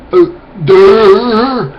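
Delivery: high, loud dopey "uh, duh"; dumb grunt. Pretend. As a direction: play a slow-witted zombie. Mocking dumb person voice.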